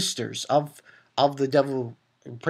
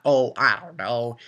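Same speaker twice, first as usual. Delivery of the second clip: usual speaking voice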